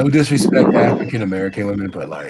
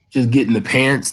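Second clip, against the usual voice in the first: ordinary delivery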